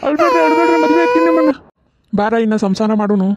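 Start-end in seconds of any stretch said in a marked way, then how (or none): 0.1-1.5 hammed-up sobbing plea; mock crying. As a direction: blubber it out like a comic crybaby. Mock wailing plea to groom.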